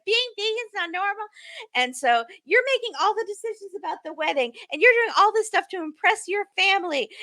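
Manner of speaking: high-pitched complaint, as from another room; high, distant mock argument; Jasmine. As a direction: imitate an aggrieved bride, pulled back from the mic. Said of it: "sounded like Jasmine was in another room".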